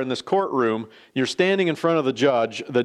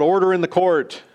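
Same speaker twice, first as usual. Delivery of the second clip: mock judge's yell, higher pitched; retold yell; 5 semitones higher than usual. Judge quiets the confused courtroom, retold yell. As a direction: call the room to order in a mild, raised yell. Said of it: "yells out".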